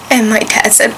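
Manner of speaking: usual speaking voice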